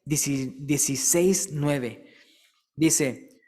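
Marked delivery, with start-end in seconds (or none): none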